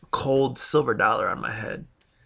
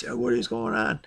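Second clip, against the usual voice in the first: sharply higher mock yell at the other driver; retold angry yell. To the driver who pulled out, a replayed higher angry yell. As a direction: replay the furious shout at her, pitched way up. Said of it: "yelling at her".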